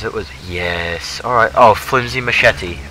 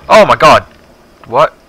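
loud startled yell; startled shout; 6 semitones higher, 13 dB louder than usual. Real, not acted. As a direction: jump out of your skin, shouting. Jump scare by game voice.

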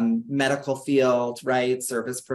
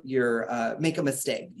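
ordinary talk

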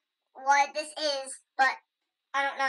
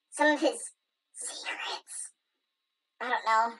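whisper; 4 semitones lower, 4 dB quieter than usual. Pretend. Hushed word while exploring.

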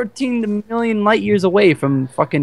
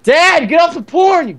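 loud, high mock shout at Dad; mock yell at dad; 10 semitones higher, 6 dB louder than usual. Jokey intro line yelled at dad, loud mock shout. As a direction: yell it like a kid caught out.

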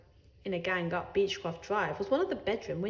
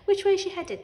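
urgent; 9 semitones higher, 5 dB louder than usual. A narrator's reading. Mother urgent at window.

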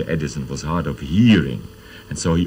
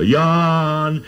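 long high call across a valley; 9 semitones higher, 4 dB louder than usual. Shown as a demonstration. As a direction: hold the name out high and loud, like calling into the mountains. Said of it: "so you can call".

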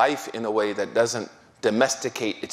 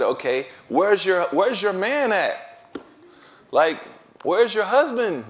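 puzzled question; 8 semitones higher, 3 dB louder than usual. Retold or imitated. Scholar questions woman, puzzled.

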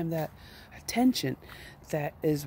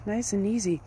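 ordinary delivery

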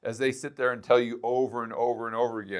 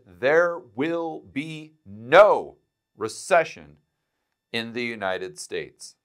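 "There will be no recession" slow, with weight on "no"; emphasized quote; slower than usual. Shown as a demonstration. Host stresses the president's promise to viewers, slow and emphatic. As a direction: lean hard on "no", word by word. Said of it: "I'd like to emphasize where he said".

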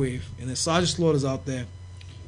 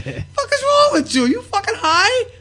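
very high, slow incredulous yell; outraged disbelief; 4 dB louder, slower than usual. Retold or imitated. Wrestler questioning the promoter's sanity, high outraged yell. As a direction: demand it in a high, stretched shout.

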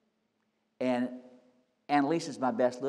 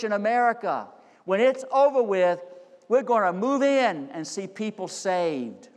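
higher and louder, building fervor; rally the church to lead; preaching at full force; 8 semitones higher, 6 dB louder, slower than usual. Acted. Pastor rallying his church against the virus, preaching full force.

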